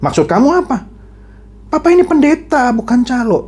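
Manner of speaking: high, indignant father; acted father bristling at an insult; starting to get angry. Pastor father challenges his son, getting angry. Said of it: "papanya mulai marah nih".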